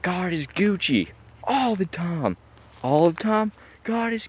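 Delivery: mocking slang. Ridiculing people who say Gucci, high mock voice. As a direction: sneer it out in a silly high voice. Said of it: "what does that word even mean".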